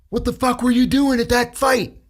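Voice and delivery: hammed-up furious officer; angry lieutenant. Lieutenant grills the speaker about the fight, mock outraged.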